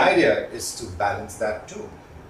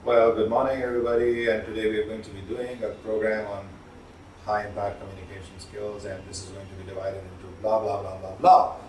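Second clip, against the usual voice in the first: low, droning presenter monotone, trailing quieter; 4 semitones lower, 4 dB quieter than usual. Shown as a demonstration. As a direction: drone through a dull workshop opening without any intonation. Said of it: "I could just talk like this".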